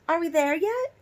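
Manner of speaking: put-on high, loud whiny kid asking; little girl voice. A narrator's reading. D W whining to parents on the long drive, high and loud. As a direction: whine it like a bored little sister.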